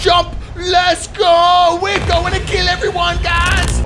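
high, excited yell to the squad; battle cry. Real, not acted. Player to viewers jumping into the match, excited battle cry.

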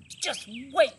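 high, loud, bursting with joy; a young fox who can't contain himself; excited small fox. A narrator's reading. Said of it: "he kept thinking".

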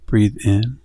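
gentle meditation guide growing hushed; guided meditation. Acted.

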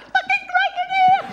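very high squeaky excited cry; services lights calling. Retold or imitated. Motorway services lights luring drivers, squeaky high cry. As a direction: lure like a cartoon sign. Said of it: "all them lights going like that".

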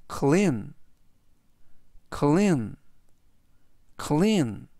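measured word-by-word reading for repetition; slow model reading. Shown as a demonstration. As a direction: give each repeat room for learners to follow. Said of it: "천천히 세 번씩 읽어드리고요". (따라 하도록 천천히 읽기)

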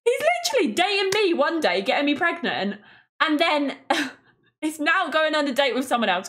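high, fast outraged disbelief; laughing disbelief at River's nerve; incredulous rant. Real, not acted. Player ranting about River dating someone else, incredulous.

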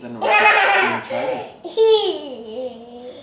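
high, slow laughing line; laughing self-mockery. Real, not acted. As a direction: laugh at your own letdown. Laughing at himself.

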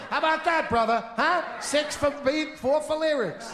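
high, pushy salesman voice; hammed-up hustler voice; mock huckster. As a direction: sell it like a cheesy pitchman. Voicing Dick Clark pitching.

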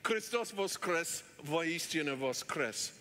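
"Christos voskres, voistino voskres" spoken slowly, each syllable carefully sounded; slow, deliberate Russian words "Christos voskres"; Russian Easter greeting said slowly and clearly. Shown as a demonstration. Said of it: "he also taught us to say".

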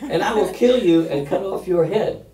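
loud, high declamation as David; forceful scripture reading. Acted. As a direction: proclaim it defiantly to the giant. Resumes dramatic reading.

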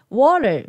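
Water with the t softened to a tap; English Water, almost "wah-der"; dropped T. Shown as a demonstration. Teacher showing how T drops, Water with no crisp t. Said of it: "Tの音も落ちますので".

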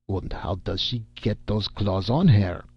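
put-on probing German-accented question; German accent, swooping up at the end; shrewd German accent. A narrator's reading. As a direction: ask pointedly with a thick German accent. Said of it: "asked Herr Schreckenheim shrewdly".

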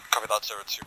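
ordinary delivery

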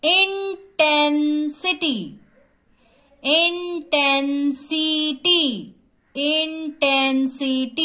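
Intensity in four long, level beats; syllable by syllable. Shown as a demonstration. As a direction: sound out intensity slowly, each syllable apart. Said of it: "एक एक word हमको जोर से बोलना है".

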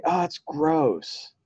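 put-on high, slow, drawn-out kid's disgust. A student in the kids' class reacts with slow, high disgust. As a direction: stretch it out like a grossed-out child. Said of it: "Somebody else might say".

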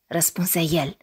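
ordinary delivery, nothing marked

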